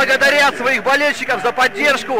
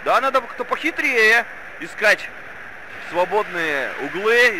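ordinary delivery

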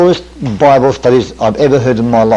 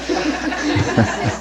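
soft breathy laugh; 3 semitones lower, 9 dB quieter than usual. Real, not acted. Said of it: "And away he went".

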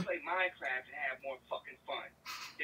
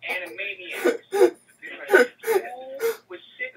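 slow, loud sneering rant; mock exaggeration; drawn-out loud mockery; 12 semitones higher, 14 dB louder, slower than usual. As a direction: stretch it out to mock her. Dragged-out mocking rant.

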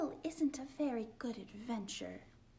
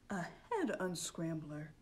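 a hint of a deep owl; lightly voiced Hoot; 5 semitones lower than usual. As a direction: say it low like a wise owl.

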